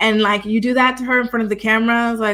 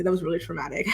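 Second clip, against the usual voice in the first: usual speaking voice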